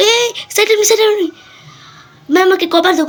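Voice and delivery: high urgent callout to teammate; cover me shout. Real, not acted. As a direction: call for cover, pitch way up. Calling teammate during firefight.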